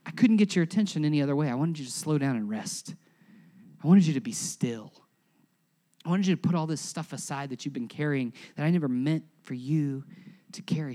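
low, soft and tender; hushed, soft-spoken divine voice; gentle voice of God. Acted. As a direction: speak as a patient God calming a tired child. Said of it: "it was like God was saying".

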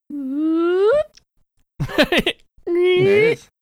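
high, loud squeaky sound effect; mock eyebrow sound.

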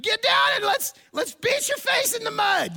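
hammed-up motivational speaker yell, very high and strained; loud, high-pitched drill-style shouting; Matt Foley. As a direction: bellow at the boys like an over-the-top coach. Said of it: "I'm doing my best Matt Foley imitation".

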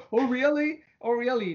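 high, incredulous "Oh, really?" imitating doubters; mock surprise. Audience shocked by his statements, mock incredulous. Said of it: "they say".

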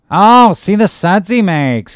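high, loud, drawn-out exclamation; Flask's excited cry. A narrator's reading. Third mate dancing up and down, excited cry. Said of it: "cried Flask, dancing up and down".

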